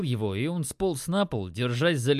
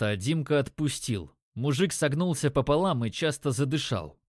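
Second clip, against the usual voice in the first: plain reading voice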